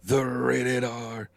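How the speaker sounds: drawn-out, booming ring announcer call. Pretend. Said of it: "you should be an announcer".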